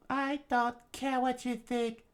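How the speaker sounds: slow, very high falsetto; tearful defiance. Acted. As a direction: defy the villain up in falsetto. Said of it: "as tears poured out".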